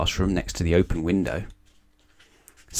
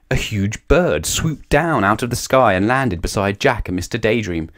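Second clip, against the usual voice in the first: plain reading voice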